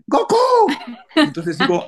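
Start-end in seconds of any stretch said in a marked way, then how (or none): 0.1-0.7 very high, excited cry of the name; shouted Goku. Retold or imitated. Fan at a dubbed movie recognising the voice, high thrilled yell. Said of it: "y estaba viendo y grité".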